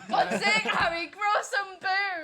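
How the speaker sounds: loud, slow, laughing yell; laughing shout. Real, not acted.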